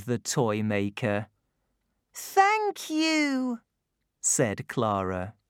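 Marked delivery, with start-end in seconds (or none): 2.1-3.6 put-on high, bright child voice; little girl voice. A narrator's reading. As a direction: speak as young Clara. Clara speaks.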